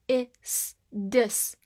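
"is" broken into sounds, then blended "dis"; syllable sounded out. Shown as a demonstration. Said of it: "D I S 发的".

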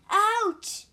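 high, loud yelp; cry of pain. A narrator's reading. Injured monk being picked up, crying out in pain. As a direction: yelp as if suddenly hurt. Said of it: "cried the monk".

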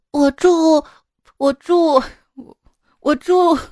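一遍遍高声重复卡住; 像小孩上台紧张结巴; 学女儿卡壳. Retold or imitated. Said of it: "我闺女说". (模仿女儿在台上结结巴巴)

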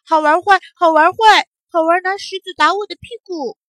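high, loud tattling complaint; angry little monkey. A narrator's reading. Little monkey tattling to the old monkey, angry and aggrieved. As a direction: wail out an indignant grievance. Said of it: "那只小猴子呢， 就更生气了".

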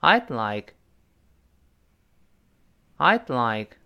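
"I'd like" with the d held before the l; model reading. Shown as a demonstration. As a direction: pause on the unreleased d of "I'd", then say "like". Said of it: "大声来一遍".

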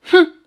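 a sharp, high, loud humph; villain scoffs at his foe with a sharp humph; scornful snort. Acted. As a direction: snort with contempt at the boy.

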